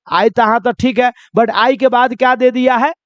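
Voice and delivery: normal talking voice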